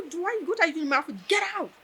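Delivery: a retold snap of a teacher ordering her out; angry dismissal. Lecturer orders the apologising student out of her office, angrily.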